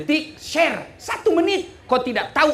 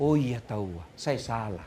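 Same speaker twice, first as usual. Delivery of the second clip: much lower and softer; meek admission; 14 semitones lower, 10 dB quieter than usual. Retold or imitated. Wife finally admits her fault, much lower and softer. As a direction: concede meekly under the breath. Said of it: "Lama pi baru berpikir".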